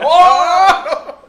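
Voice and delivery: very high, loud whooping "oh"; grito agudo. Real, not acted. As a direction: let out a long, loud high cry. Grito burlón tras decir tortillera. (grito muy agudo y fuerte)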